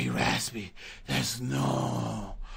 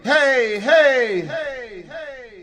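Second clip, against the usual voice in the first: much higher, louder echoing hey; delay effect; 12 semitones higher, 8 dB louder, slower than usual. Shown as a demonstration. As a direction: shout as if echoing back. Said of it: "This is delay".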